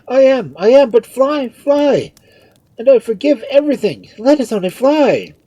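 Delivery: high, very loud plea to flee; desperate father crying out; frantic urging. A narrator's reading. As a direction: shout it in panic. Father urges frantic flight.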